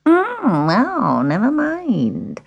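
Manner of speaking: swooping, drawn-out witch voice; scheming old witch. Acted. Witch leaves her offer open, sly drawn-out voice. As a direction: feign indifference with a drawn-out purr.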